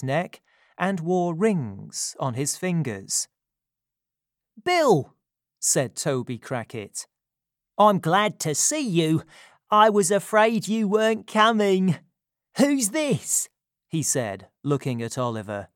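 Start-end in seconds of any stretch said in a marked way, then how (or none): 4.6-5.1 high, loud exclamation of a name; hearty greeting. A narrator's reading. Toby Crackit greets his partner. 7.8-13.5 high-pitched, loud crook's voice; lively character voice, pitched well up; animated greeting. A narrator's reading. Toby greets Sikes, asks about boy.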